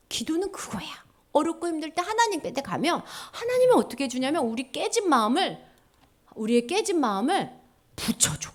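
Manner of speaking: ordinary delivery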